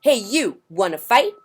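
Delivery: high, loud cocky taunt; grouchy ladybug challenge. Acted. Grouchy ladybug challenging a yellow jacket, high and loud. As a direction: call out a fight, brash.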